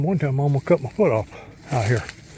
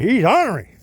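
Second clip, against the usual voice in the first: a hint of grandpa, higher and a bit louder; imitating grandpa; 6 semitones higher, 4 dB louder than usual. Man quotes his grandpa's old saying, lightly imitated. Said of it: "As my grandpa used to say".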